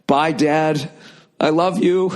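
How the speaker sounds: high-pitched, meek farewell ending in a laugh; hammed-up sweet, submissive goodbye; mock cowed family member. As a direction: call goodbye like a timid child. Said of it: "like a battered wife".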